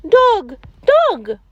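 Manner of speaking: put-on eager child repeating "alligator"; kids repeating. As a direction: squeal it back like a small pupil. Pretend pupils repeat the word.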